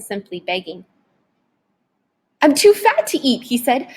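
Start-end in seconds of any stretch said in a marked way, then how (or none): 2.4-3.4 high, desperate bargaining; frightened pleading. A narrator's reading. Scared boy talking fast to save himself.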